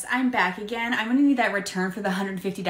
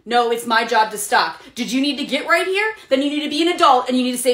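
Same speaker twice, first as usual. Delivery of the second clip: louder, higher retort; irritated clerk; 5 semitones higher, 5 dB louder than usual. Acted. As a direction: push back hard at the customer. Clerk arguing back at customer.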